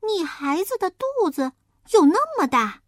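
high, loud, wide-eyed little pig; a piglet who can't believe it; astonished piglet. Acted. Piglet asks the wolf in high surprise.

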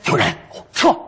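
furtive spy warning; short, urgent undercover whisper; secretive whisper. Pretend. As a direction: hiss a hushed alert to a partner. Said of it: "小声嘀咕着说".

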